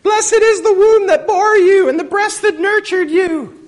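shrill high screech, loud and drawn out; hammed-up accented woman yelling from a crowd; screaming woman in crowd. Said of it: "raised her voice and said".

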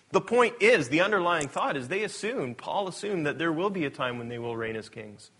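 ordinary talk

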